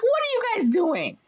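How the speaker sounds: very high-pitched incredulous challenge; mock indignation. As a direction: confront a mob in hammed-up disbelief. Heroine confronts the angry mob.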